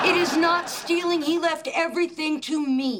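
high-pitched, indignant self-defence; indignant retort. Acted. Accused of stealing, she claims the inheritance, indignant retort.

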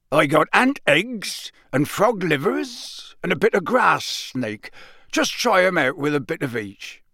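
a teacher brushing off a pupil's jab; rough Hagrid. Acted. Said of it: "Then he said roughly".

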